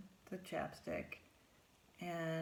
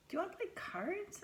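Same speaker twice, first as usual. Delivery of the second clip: hopeful invitation; 12 semitones higher, faster than usual. Retold or imitated. Reaching for straws with the girl.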